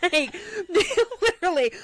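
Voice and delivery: high, loud laughter spilling into words; cracking up mid-sentence; laughing hard. Real, not acted. Telling a friend the date story, breaking into high loud laughter.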